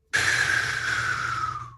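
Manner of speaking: long, breathy exhale while thinking; long sigh. Real, not acted. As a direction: let out a drawn-out sigh. Player stuck deciding on turn.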